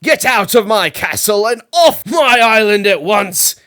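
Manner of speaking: loud, high furious roar; roaring king. A narrator's reading. King roaring at the rude wizard, furious. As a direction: bellow him out of the castle. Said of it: "King Burger jumped to his feet and roared".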